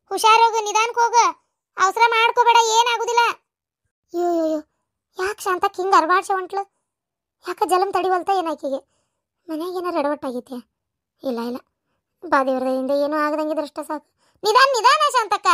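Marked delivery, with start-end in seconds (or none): 0.1-3.2 higher and louder, calling after her; anxious calling out. Acted. Calling after departing Shantakka. 14.4-15.5 higher and louder, calling after her; anxious calling out. Acted.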